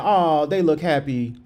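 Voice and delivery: ordinary talk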